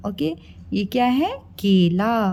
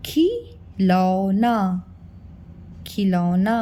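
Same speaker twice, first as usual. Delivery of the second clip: put-on slow, stretched word for a child; slow sounding out; 3 semitones lower, much slower than usual. Shown as a demonstration. Teacher modeling slow careful reading for kids. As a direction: sound out खिलौना slowly. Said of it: "पहले इसको आप पढ़ना सीखिए".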